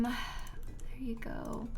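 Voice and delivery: whisper. Real, not acted.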